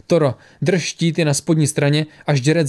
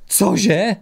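higher, startled question; stunned blurt; 5 semitones higher than usual. A narrator's reading. Captain hearing bad news, stunned blurt. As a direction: blurt it out in shock. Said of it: "Vyhrkl paralizovaný Torat".

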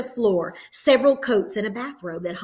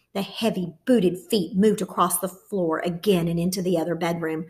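ordinary delivery, nothing marked

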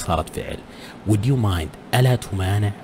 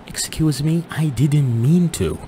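polite apology; 7 semitones higher, much slower than usual. Shown as a demonstration. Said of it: "من الجيد إنك تقول".

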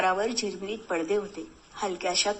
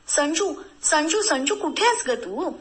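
calling out; 6 semitones higher, 3 dB louder than usual. Acted. Husband runs calling his wife.

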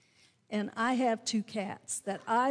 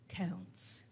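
whispered; 5 semitones lower, 11 dB quieter than usual. Acted. Preacher landing the final point to the congregation in a whisper. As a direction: whisper it, much softer.

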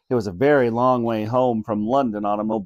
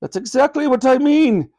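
high, loud royal scolding; queen scolding granny's groveling; haughty queen; 12 semitones higher, 7 dB louder than usual. A narrator's reading. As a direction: scold them from a great height. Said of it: "replied the Queen".

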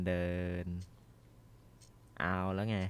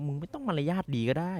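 sarcastic jab; 8 semitones higher, 4 dB louder than usual. Real, not acted. Mocking knocking enemy.

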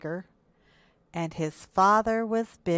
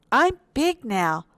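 slow, raised, louder boast; proud cry; 6 semitones higher, 5 dB louder than usual. A narrator's reading. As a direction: announce it proudly, stretching the words. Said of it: "cried Little Hippo".

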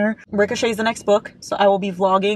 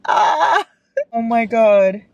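sudden tearful cry; real tearful gasp; 3 semitones higher, 4 dB louder, much slower than usual. Real, not acted. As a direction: break into a sob of shock. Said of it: "I can do this without crying".

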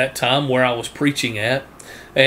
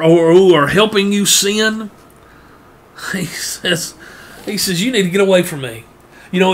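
higher, louder full-force preaching; fiery preaching; 6 semitones higher, 5 dB louder than usual. Acted. Preacher urging the congregation away from sinful friends, fervent and raised. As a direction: build the sermon to a fervent peak.

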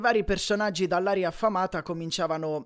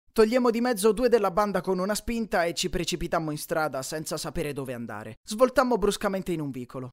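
plain reading voice

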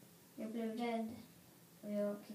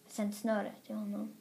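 plain reading voice

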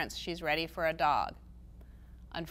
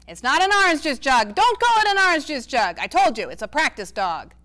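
exasperated grandpa; 5 semitones higher, 10 dB louder than usual. A narrator's reading. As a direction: throw up your hands at her. Grandpa Ace voiced in reading.

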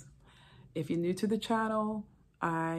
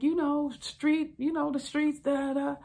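evasive husband; 9 semitones higher, 3 dB louder than usual. Retold or imitated. Husband evading question.

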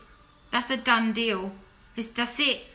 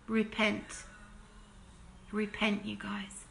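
hushed plea; 3 semitones lower, 10 dB quieter than usual. Real, not acted. Pleading with listeners to repent.

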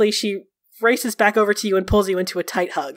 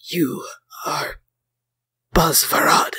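weak, lower voice with heavy pauses; slow, solemn pronouncement; 4 semitones lower, slower than usual. A narrator's reading. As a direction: utter it haltingly, as a grave honour. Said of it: "he slowly looks up at you".

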